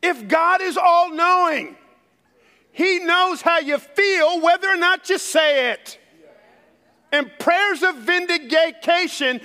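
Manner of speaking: high-pitched, driving sermon delivery; full-force preaching. Real, not acted. Preacher challenging conservatives about emotion in prayer, full-force preaching. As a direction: press the point hard on the congregation.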